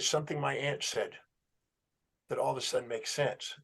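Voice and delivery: very hushed and low, imitating her; mildly tearful, dropped almost to nothing; retold tearful realization. Client recalling her aunt's words to the therapist, hushed and tearful. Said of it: "started crying".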